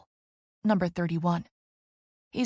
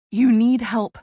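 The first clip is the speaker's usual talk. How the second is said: panting; 3 semitones higher, 8 dB louder, slower than usual. A narrator's reading. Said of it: "I pant".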